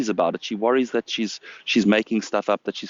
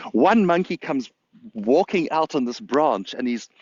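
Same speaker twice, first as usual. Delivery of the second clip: usual speaking voice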